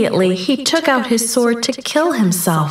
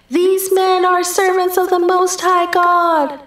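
put-on high, sustained slave girl's cry; slave girl ringing out; 10 semitones higher than usual. A narrator's reading. Slave girl following Paul and Silas down the street, ringing out. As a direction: ring it out high on one level note. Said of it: "shouting over and over".

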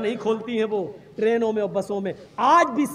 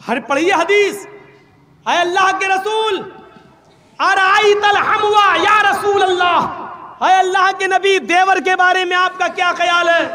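loud, high, sustained oratory; full-force preaching; 5 semitones higher, 7 dB louder, slower than usual. Real, not acted. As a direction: proclaim the hadith with long drawn-out words. (बुलंद आवाज़ में खींचकर तकरीर)

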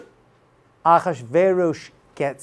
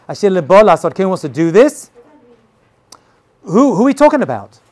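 ordinary talk

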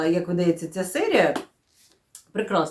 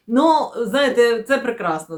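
usual speaking voice

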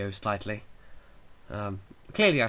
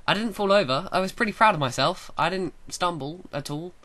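excited; 7 semitones higher, 6 dB louder than usual. Real, not acted. Excited boast about skating.

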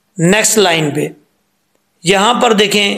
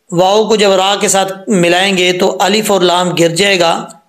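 ordinary talk

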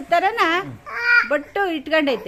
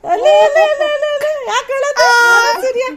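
loud coaxing calls; 9 semitones higher, 10 dB louder than usual. Real, not acted. Mother coaxing her crying baby to look, high loud calls.

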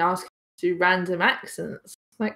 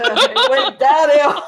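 higher, broken up by laughter; laughing while talking; 5 semitones higher, 6 dB louder, slower than usual. Real, not acted. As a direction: barely get the story out, laughing. Laughing at own story.

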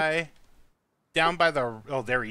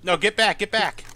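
urgent shout; 6 semitones higher, 4 dB louder than usual. Real, not acted. Urgent warning to teammate.

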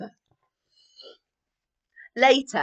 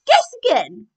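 higher, loud sharp queen; snapping queen; 9 semitones higher, 15 dB louder than usual. Acted. Queen snapping at her family's guesses: loud and high. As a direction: cut them off regally. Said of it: "snapped the queen".

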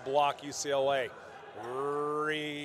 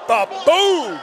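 much higher, much louder "Ba-boom!"; excited shout; 12 semitones higher, 15 dB louder than usual. Real, not acted. Commentator yelling as McHenry crushes the ball, thrilled shout. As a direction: burst out as the ball is smashed. Big kill called live.

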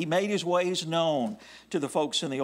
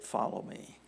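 quiet father; 6 semitones lower, 7 dB quieter than usual. Retold or imitated. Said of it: "Dad said".

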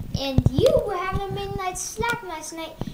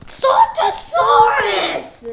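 shouted, slow and drawn out; sputtering foiled villain; 4 semitones higher, 7 dB louder, much slower than usual. Acted. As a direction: shake your fist and roar the name. Villain cursing foe's name.

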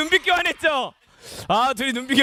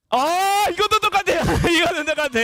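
high-pitched exclamation breaking into laughter; amused disbelief; 5 semitones higher, much faster than usual. Real, not acted. Host sees the players tie, laughing in surprise. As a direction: cry out at the tie, then laugh.

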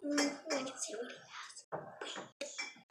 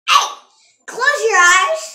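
sharp loud shout; 5 semitones higher, 10 dB louder than usual. Real, not acted. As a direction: yell at him to close his eyes. Catching brother peeking.

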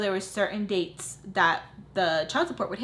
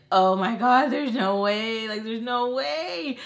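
retold panic; 3 semitones higher, 4 dB louder, slower than usual. Retold panic at threat to take son.

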